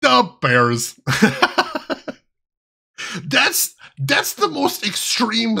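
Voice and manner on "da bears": exaggerated Chicago "Da Bears"; hammed-up Chicago accent; silly Chicago accent. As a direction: play a caricature Chicago sports fan. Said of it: "silly version of the Chicago accent".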